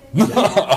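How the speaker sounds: sudden high laugh; high burst of laughter. Real, not acted. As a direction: laugh out loud. Laughs at own remark.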